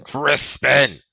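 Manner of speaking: sharp, loud scold of the name. A narrator's reading. Bear scolds Crispin for wandering off, loud scolding. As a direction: snap the name like a cross guardian. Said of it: "he scolded".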